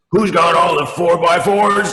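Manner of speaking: big, gruff, gravelly call, high, loud and slow; gruff sergeant voice. Shown as a demonstration. The sergeant calls out to the soldiers in the Chrysler commercial, gruff. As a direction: boom it out like a drill sergeant in a car ad. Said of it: "big, gruff, gravelly voice".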